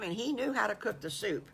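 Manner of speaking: ordinary delivery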